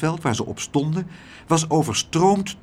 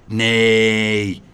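loud, long-held plaintive wail; pitying cry; 2 semitones lower, 6 dB louder than usual. A narrator's reading. All three plead for the rabbit, pitying cry. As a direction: draw it out as a protest full of pity. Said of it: "riepen ze alle drie meewarig".

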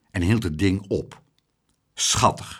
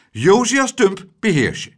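higher, louder stern order; 11 semitones higher, 9 dB louder than usual. Acted. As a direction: command him to control himself.

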